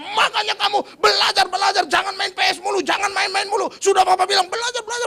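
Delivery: loud, high furious scolding at the son; angry father yelling. Retold or imitated. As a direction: yell at him to study. (ayah membentak anak dengan marah)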